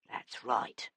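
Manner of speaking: low, rough whispered threat. A narrator's reading. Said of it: "whispered Sebastian, his voice pitched low and rough".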